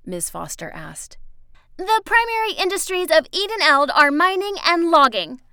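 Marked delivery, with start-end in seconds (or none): 1.8-5.4 high, loud singsong recital; loud, high-pitched mock quoting. A narrator's reading. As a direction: parrot a school fact in a high voice. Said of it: "quoted in a high voice".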